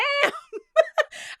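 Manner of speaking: high-pitched cackling burst; real laughter. Real, not acted. Host cracking up at the vote for Wes, real laughter. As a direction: lose it laughing after one word.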